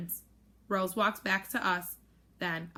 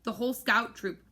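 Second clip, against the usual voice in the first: usual speaking voice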